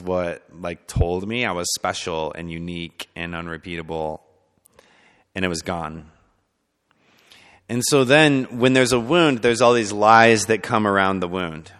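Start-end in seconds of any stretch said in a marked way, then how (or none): none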